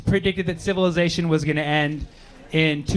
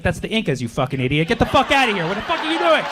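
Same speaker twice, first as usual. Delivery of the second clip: hammed-up angry lecture; mock rant; 7 semitones higher than usual. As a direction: tear into her in mock fury. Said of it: "I was like".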